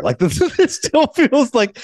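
high, drawn-out words through laughter; laughing retelling. Retold or imitated. Host retelling Marty on Port Authority, laughing. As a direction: let the laugh stretch the words as you retell. Amused retelling of Scorsese's worry.

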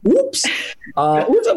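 very high, squeaked little exclamation; mock startled yelp. German interviewer reacting to the camera after a gaffe, mock startled squeak. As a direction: imitate a flustered interviewer, voice shooting up.

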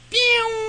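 sharp high "pew" blast; air horn noise. Retold or imitated. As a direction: blurt a horn sound.

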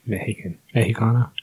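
says Mexican the Spanish way, very low and soft; mock Spanish accent. Mocking Spanish pronunciation of Mexican.

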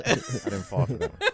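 hammed-up football player voice; squeaky mock voice.

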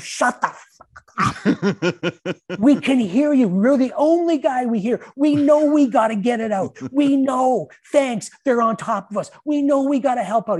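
high, loud furious yelling; retold screaming. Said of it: "He's screaming at me on the bench".

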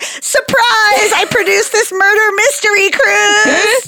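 high, singsong surprise announcement; playacted grand reveal; mock big reveal. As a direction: announce it high and drawn out, ta-da style. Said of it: "And then you're like".

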